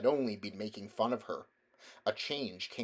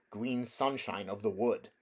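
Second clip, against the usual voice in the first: ordinary delivery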